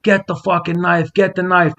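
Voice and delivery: high-pitched mock yell; retold yelling. Retold street fight, man demanding knife.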